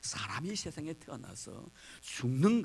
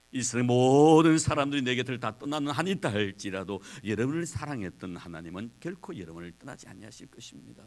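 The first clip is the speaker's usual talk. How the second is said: ordinary talk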